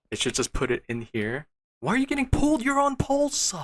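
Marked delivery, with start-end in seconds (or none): 1.8-3.5 very high, loud frustrated whine at the machine; exasperated squeal. Real, not acted. Scolding the stubborn machine, high-pitched and loud. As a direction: squeal it like the machine is betraying you.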